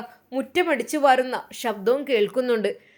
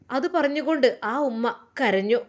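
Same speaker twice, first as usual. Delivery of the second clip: plain reading voice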